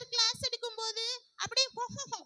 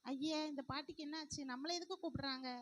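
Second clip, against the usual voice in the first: much lower and softer, to herself; puzzled aside; 8 semitones lower, 10 dB quieter than usual. Acted. Girl wondering aloud why the grandmother calls her. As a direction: mutter it aside.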